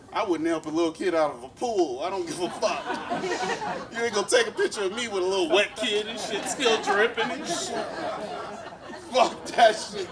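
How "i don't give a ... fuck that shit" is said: laughing while ranting. Real, not acted. Laughing through the bit.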